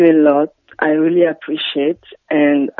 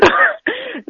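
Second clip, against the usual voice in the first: high laugh. Real, not acted. Caller laughs at accent remark.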